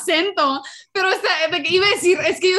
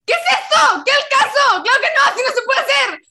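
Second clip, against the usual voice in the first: high, loud exasperated yelling at homework; frustrated shouting; 10 semitones higher, 7 dB louder, faster than usual. Retold or imitated. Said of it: "an audible representation of how Raquel and Mia sound". (imitando a Raquel y Mia gritando frustradas)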